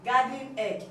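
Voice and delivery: normal talking voice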